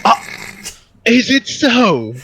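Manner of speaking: intrigued Harris figure. Acted. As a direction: stretch it out in mock surprise. Game master as Harris reacts to agent.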